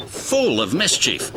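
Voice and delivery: ordinary delivery, nothing marked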